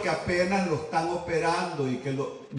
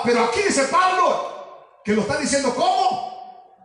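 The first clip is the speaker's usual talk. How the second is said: rising preacher question; 7 semitones higher than usual. Acted. Preacher builds question to crowd.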